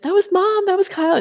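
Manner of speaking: a high, mocking take on the sister's excuse. Mocking the sister's excuse to her, pitched high.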